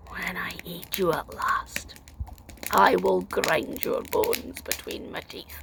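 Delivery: low unvoiced whisper of a waking giant; giant's menacing whisper. Giant waking to find the girl on his belly, menacing whisper. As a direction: hiss the threat slowly. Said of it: "he said".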